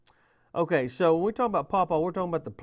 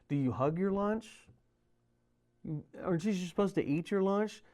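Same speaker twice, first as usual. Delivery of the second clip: ordinary talk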